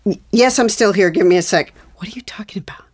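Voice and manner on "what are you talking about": unvoiced hissed exchange; whispered argument. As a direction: argue in whispers beside the phone. Whispered argument during call.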